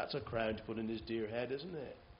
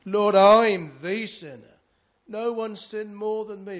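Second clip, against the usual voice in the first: crying out, much louder and higher; anguished confession; 6 semitones higher, 13 dB louder than usual. Acted. To the Lord, a penitent confesses loudly and with anguish. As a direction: cry it out to the Lord in full earnest.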